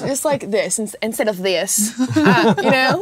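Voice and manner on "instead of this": slow Southern US drawl; southern accent. Shown as a demonstration. Showing friends the accent she grew up with, slow southern drawl. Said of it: "start talking in a southern accent".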